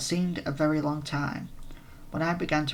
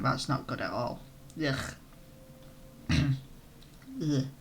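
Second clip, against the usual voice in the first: croaky, losing voice; hoarse voice; 6 dB quieter, slower than usual. Real, not acted. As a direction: voice cracking and fading. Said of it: "my voice is still going".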